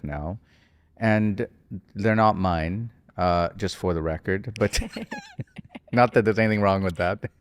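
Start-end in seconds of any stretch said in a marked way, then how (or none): 4.9-5.9 short high-pitched giggle. Real, not acted. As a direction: giggle at your own joke. Laughs at own joke.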